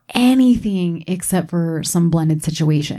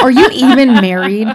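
louder, laughing through the question; laughing punchline; 4 semitones higher, 7 dB louder than usual. Real, not acted. Host laughing as she challenges married listeners. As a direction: toss it out mid-laugh.

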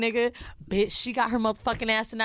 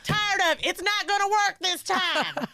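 mock fed-up wife; 9 semitones higher than usual. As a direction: drag out the words as she gives up on him. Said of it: "She's like".

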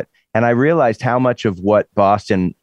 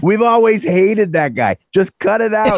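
hammed-up Boston bro; Boston accent; 12 semitones higher than usual. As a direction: play a Boston guy helping the thief. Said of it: "They'd be like".

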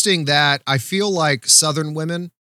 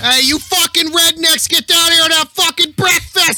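high-pitched Boston shouting; Boston accent; 13 semitones higher, 3 dB louder than usual. Acted. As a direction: holler like an irate Bostonian, very high. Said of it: "You hear the Boston accent".

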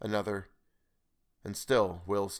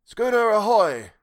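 slow, loud, higher call across the water; queer hail; 12 semitones higher, 10 dB louder, slower than usual. Acted. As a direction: hail the ship from far off in the dark. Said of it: "the queer voice came again".

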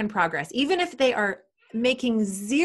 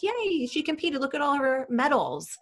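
mock gushing, much higher; gushing proud parent; 7 semitones higher than usual. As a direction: show off the medals like a proud social media parent. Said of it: "It's like".

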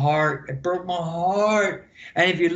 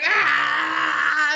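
laughing, pitched way up, half shouting; laughing yell; 9 semitones higher, 3 dB louder than usual. Retold or imitated. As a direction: crack up while yelling the entrance. Retelling being pressed around ring.

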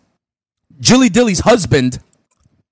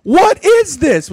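scared and surprised; 14 semitones higher, much slower than usual. Real, not acted. Opening a scary gift, high voice.

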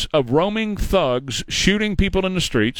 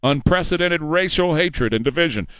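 ordinary talk, nothing marked

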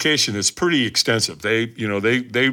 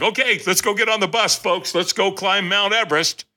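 pumped-up rallying; 7 semitones higher than usual. Retold or imitated. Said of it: "It's like".

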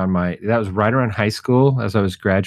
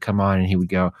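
ordinary talk

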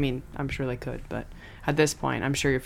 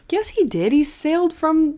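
indignant protest; 10 semitones higher than usual. Real, not acted. Protesting Steve's self-doubt.

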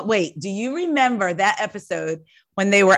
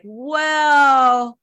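hesitant, loaded well; slower than usual. Retold or imitated. Friends cautioning her about how Twitter takes it, hesitant and drawn-out. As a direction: stretch it out as a tactful hedge. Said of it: "why you saying it like that".

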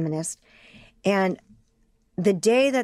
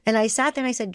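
ordinary delivery

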